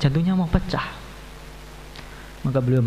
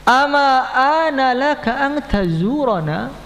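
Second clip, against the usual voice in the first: the Prophet's words; 9 semitones higher, much slower than usual. Acted. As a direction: stretch each Arabic word like a gentle rebuke from afar. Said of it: "Beliau mengatakan". (teguran Nabi yang lambat, tinggi dan keras)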